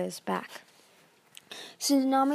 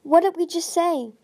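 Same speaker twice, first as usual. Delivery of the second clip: higher, louder, exasperated; exasperated demand; 8 semitones higher, 7 dB louder than usual. A narrator's reading. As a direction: demand an answer, fed up. Frustrated Tsunami demands.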